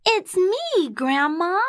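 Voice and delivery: put-on high little girl voice; high-pitched girl announcing herself. A narrator's reading. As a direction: call out in a high child's voice. Real girl answers at door.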